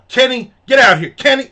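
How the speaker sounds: high, loud imitated summons; mad yelling. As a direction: yell for the cook like a mad waitress. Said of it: "And she's yelling at the".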